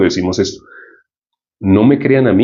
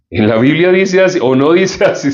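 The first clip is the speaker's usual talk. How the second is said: ordinary talk, nothing marked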